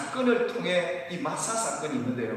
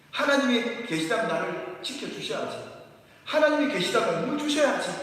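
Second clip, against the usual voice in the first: higher, louder mock demand; imitating a demanding complaint; 6 semitones higher, 4 dB louder than usual. Pastor acting the people demanding water, higher and louder. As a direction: insist God owe them water, voice climbing. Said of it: "라는 게 아니겠습니까?".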